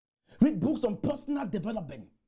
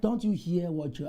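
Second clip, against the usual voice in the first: normal talking voice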